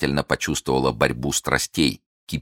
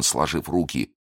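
plain reading voice

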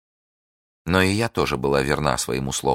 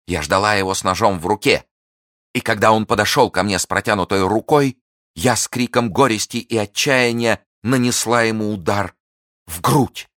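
higher and louder narration rising to the strike; climactic, raised narration; dramatic build; 5 semitones higher, 5 dB louder than usual. A narrator's reading. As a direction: tell the stab with mounting intensity.

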